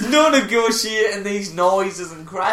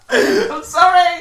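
high, loud, wailing laugh on the words; laughing mock apology; 11 semitones higher, 7 dB louder, slower than usual. Pretending to apologise to Big Head, helpless laughing wail. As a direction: cry it out slowly, breaking with laughter.

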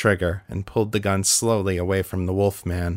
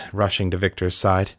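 ordinary delivery, nothing marked

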